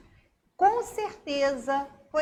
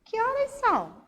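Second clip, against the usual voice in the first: high, questioning voice; asking a question; 11 semitones higher, 4 dB louder than usual. Shown as a demonstration. Teacher demonstrating a question to pupils, high and questioning. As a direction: ask it as a puzzled child would. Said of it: "ponto de interrogação".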